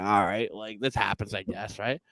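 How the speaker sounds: low, soft, giving in; quiet resignation. Retold or imitated. Diner retelling his reply to the card machine excuse, quietly resigned. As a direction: accept it under protest, voice dropped low.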